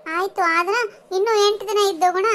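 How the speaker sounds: put-on high, loud childish begging; pleading child. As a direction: whine loudly to stay longer. Child begs to stay longer. (ಜೋರಾಗಿ ಬೇಡುವ ಪುಟ್ಟ ಮಗು)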